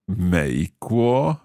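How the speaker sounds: very slow, syllable by syllable; slow learner's repeat. Shown as a demonstration. Learner repeating the teacher's Chinese phrase, very slowly. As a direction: draw out each tone carefully. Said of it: "saying each of these phrases quite slowly".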